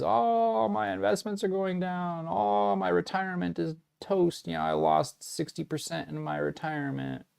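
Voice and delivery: high, whiny voice mocking panicked investors; hammed-up crying over lost retirement money; mock whining. Mocking investors who whine about their crashing portfolios, in a high, hammed-up wail. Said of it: "everyone's gonna be screaming and crying".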